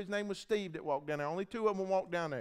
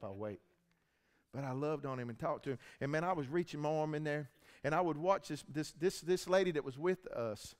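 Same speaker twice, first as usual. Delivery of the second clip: ordinary talk, nothing marked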